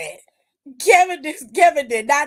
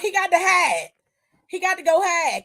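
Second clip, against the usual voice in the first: usual speaking voice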